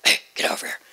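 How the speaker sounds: a yell done in a breathless whisper. Retold or imitated. Daughter imitating her father yelling in a whisper. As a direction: shout it with no voice, only breath. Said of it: "So if he was yelling".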